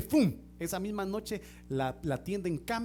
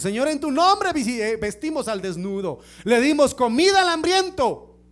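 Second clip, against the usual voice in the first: rising, louder pleading litany; mounting loud plea; 4 semitones higher, 10 dB louder than usual. Retold or imitated. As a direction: build each line of the plea louder. Preacher voices the false servants' pleading.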